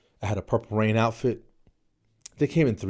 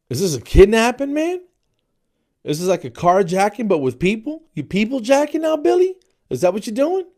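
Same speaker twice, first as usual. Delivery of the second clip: high, loud outraged questioning; mock incredulity; 12 semitones higher, 7 dB louder than usual. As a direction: grill the singer in climbing disbelief.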